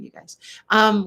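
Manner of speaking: normal talking voice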